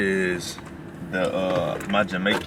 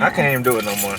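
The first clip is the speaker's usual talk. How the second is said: normal talking voice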